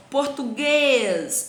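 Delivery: português with GUÊS drawn out long and strong; stressed last syllable. Shown as a demonstration. Teacher shows pupils the stronger syllable, GUÊS stretched long. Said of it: "ele tem um som mais forte".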